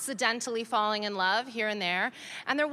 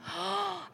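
a sharp, quiet gasp of mock shock; 6 semitones higher, 6 dB quieter than usual. Caught out by her daughter, a quiet mock gasp.

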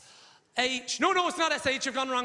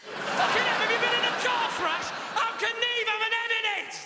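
incredulous outburst; 11 semitones higher than usual. Acted. Comic shouting at the front row about the M. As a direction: shout it like you can't believe it.

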